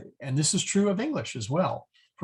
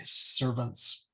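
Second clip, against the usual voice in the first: ordinary delivery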